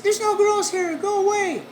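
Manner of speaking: high, loud woman shooing him off; annoyed woman behind the door. Retold or imitated. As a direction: shout go away through the door. Woman shoos him away.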